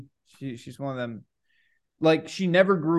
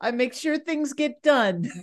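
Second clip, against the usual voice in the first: hammed-up high professor voice; imitating the professor; 8 semitones higher than usual. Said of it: "he talks like this".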